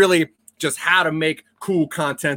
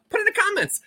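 playful high-pitched squeak; high squeaky voice; 4 dB quieter, faster than usual. Acted. Repeats the catchphrase to viewers.

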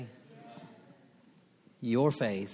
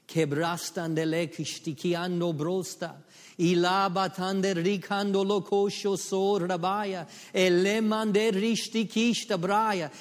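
praying in tongues; 8 semitones higher, 7 dB louder, slower than usual. Acted.

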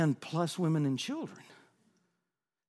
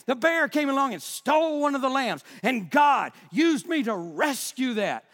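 preaching at full force; 6 semitones higher than usual. Real, not acted. Pastor to congregation, preaching at full force. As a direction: stir the whole church up, full throttle. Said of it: "I'm sorry I'm so stirred up".

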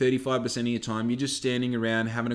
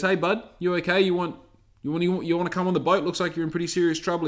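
higher, lightly raised calling-out voice; mild acted yell; 6 semitones higher, 3 dB louder, faster than usual. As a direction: call out to someone from a passing boat. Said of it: "a bloke yells out over the side".

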